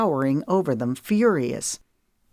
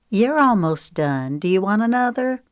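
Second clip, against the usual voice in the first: higher, louder, bright offer to the table; higher breezy woman; 5 semitones higher, 4 dB louder than usual. Acted. Woman offering drinks to the group, higher and louder. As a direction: sing out the offer. Paulette voiced.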